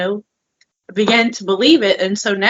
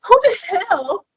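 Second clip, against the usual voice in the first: amazed; 11 semitones higher than usual. Retold or imitated. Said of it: "I'm like".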